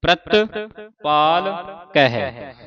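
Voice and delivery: ਪ੍ਰਤਪਾਲਕ drawn out syllable by syllable; ਪ੍ਰਤਪਾਲਕ spoken slowly in separate parts. Shown as a demonstration. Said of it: "ਹੁਣ ਇਕੱਠਾ ਹੀ ਉਚਾਰਨ ਕਰਨਾ ਹੈ".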